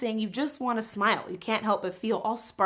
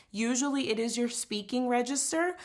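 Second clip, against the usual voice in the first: normal talking voice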